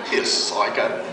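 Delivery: put-on teen walking away from the youth worker, scornful; scornful mutter. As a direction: toss it off under your breath.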